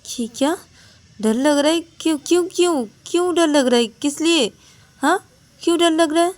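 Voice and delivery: anxious, repeated why; alarmed questioning. Acted. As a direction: friend alarmed by the fear.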